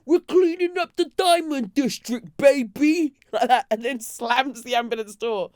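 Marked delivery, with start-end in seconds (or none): 0.1-3.1 high, loud, hammed-up movie line; Gotham City vigilante. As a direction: proclaim it like a comic-book vigilante. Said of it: "like a scene from Gotham City".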